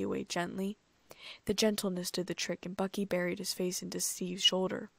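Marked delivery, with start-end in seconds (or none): none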